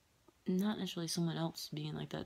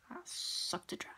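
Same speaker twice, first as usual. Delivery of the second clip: creepy whisper; 13 semitones higher, 4 dB quieter than usual. Pretend. Spooky claim about the stone.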